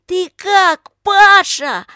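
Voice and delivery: high, very loud call; shouting through cupped hands. A narrator's reading. Lika checking on Pasha in the water, high shout. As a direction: holler it across the lake. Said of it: "закричала, сложив руки рупором".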